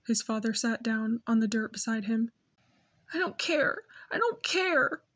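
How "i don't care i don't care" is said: high, broken cry of grief. Acted. Grieving boy to his father, crying high. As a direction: wail it out through tears. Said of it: "He was crying now".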